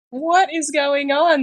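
high, drawn-out mock wail of confusion; bewildered screaming baby. Speaking as a baby just come out into this world, bewildered mock cry. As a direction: voice a baffled newborn, high and slow. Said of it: "they come out screaming".

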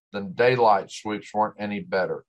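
plain reading voice

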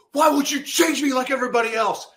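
high, strained cry of anguish at God; raw, pleading yell at the sky; cursing at God. Retold or imitated. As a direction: hurl it up at God in despair. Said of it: "I started cursing at God".

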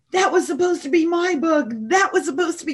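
high, aggrieved complaint; upset with God. Retold or imitated. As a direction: protest the unfairness. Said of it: "really upset with God".

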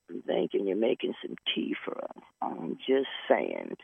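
whispered wink at the husband; mock whisper. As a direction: breathe it like a secret nudge. Wife's whispered aside to husband.

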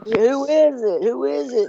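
high-pitched retold panicky question; mock screaming. Man on the cliff questioning the voice, mock screaming. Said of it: "he started screaming".

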